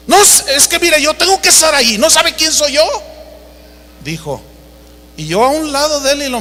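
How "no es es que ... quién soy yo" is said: high-pitched mock self-importance; haughty indignation. Famous preacher pulls rank on airline clerk, imitated haughty indignation. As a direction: pull rank like an offended celebrity. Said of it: "Habla de altanería".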